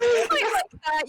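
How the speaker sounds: high, loud teasing "You might like that". Real, not acted. GM teasing the players.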